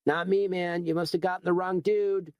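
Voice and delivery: lightly voiced shrugging hoodlum; casual young punk. McManus denies it.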